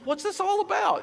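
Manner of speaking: high-pitched mock bewilderment; retold bafflement. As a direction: act baffled, pitch jumping right up. Retold puzzled question to courier.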